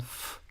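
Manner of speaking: "F" as a voiceless fff, no vowel; isolated soft "F" sound, not the letter name; bare consonant sound. Shown as a demonstration. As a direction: sound out "F" like the start of phone. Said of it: "It's a double consonant sound".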